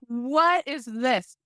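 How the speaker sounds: slow, stunned what is this; startled nurse. Retold or imitated. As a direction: drag it out in shock. Nurse shocked lifting the dress.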